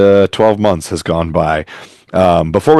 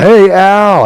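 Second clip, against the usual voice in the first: high, loud holler of a student section; imitated crowd yell; 13 semitones higher, 6 dB louder than usual. Student section yelling at the man they mistook for Al, imitated yell. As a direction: call out like kids yelling from the stands. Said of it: "they were all yelling".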